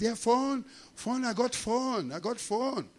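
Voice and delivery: high, eager broken-English phone finder; extra-friendly imitation. Driver without English reassuring a customer, extra friendly. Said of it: "he's extra friendly".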